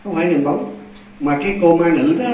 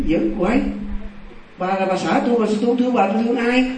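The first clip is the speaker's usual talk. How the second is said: high-pitched, incredulous husband; retold protest climbing ever higher; exasperated protest; 6 semitones higher than usual. Husband insists she is his wife, exasperated protest.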